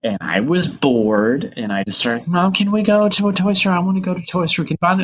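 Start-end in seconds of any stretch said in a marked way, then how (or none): 2.3-5.0 put-on high, pleading little-kid whine; whiny kid. Retold five-year-old nagging mom at lunch, whiny. Child whining for toy store.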